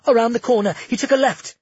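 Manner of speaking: high, loud urgent call; shout. A narrator's reading. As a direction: yell the direction as she runs. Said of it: "Cleo shouted".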